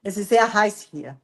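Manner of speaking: "Es ist sehr heiß hier" on an even, emotionless tune; flat German. Shown as a demonstration. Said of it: "não tem nenhuma emoção nessa frase". (alemão sem emoção, "Es ist sehr heiß hier" bem reto)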